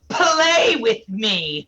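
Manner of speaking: drawn-out, high game-character taunt; Sabrina. Acted. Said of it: "Sabrina when she goes".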